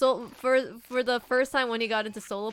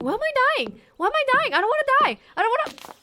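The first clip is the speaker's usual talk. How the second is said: very high, loud desperate wail; mock panicked whine of a dying player; panicked dying cry; 14 semitones higher, 7 dB louder, much faster than usual. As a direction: wail as if life is slipping away. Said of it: "it's like".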